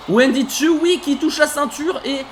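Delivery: excited call. Real, not acted. Commentator cheering Wendy Choo touching the belt, excited. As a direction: rise up as she reaches the belt.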